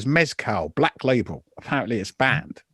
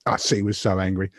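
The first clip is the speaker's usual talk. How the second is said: ordinary talk, nothing marked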